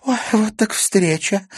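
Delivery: high, breezy pretend surprise; fake casual. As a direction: act like it's a chance meeting. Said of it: "стараясь выглядеть непринуждённо".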